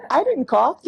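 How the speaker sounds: high, giggling laugh breaking through the words; real laughter. Real, not acted. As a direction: laugh it off, caught off guard. Laughing at her own mistake.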